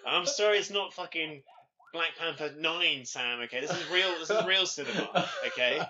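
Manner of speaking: high, loud hammed-up cinephile rant, laughing at the end; mock film snob. Film critic mock-scolding Sam over blockbusters, hammed-up snob rant. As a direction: scold like an offended arthouse purist.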